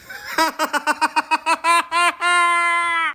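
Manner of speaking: very high, loud cackle of laughter; shrieking laugh. Real, not acted. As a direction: burst into a high squealing laugh. Laughs after mocking rant.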